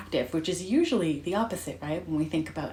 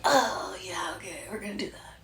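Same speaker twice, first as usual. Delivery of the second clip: reluctant acceptance. Retold or imitated. Reader reacting to the word surrender, reluctantly accepting. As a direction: give in half-heartedly, voice dropping to near whisper.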